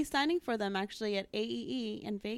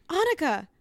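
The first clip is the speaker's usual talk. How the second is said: fan voice; 9 semitones higher, 9 dB louder than usual. Retold or imitated. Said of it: "fans would be like".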